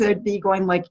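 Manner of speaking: usual speaking voice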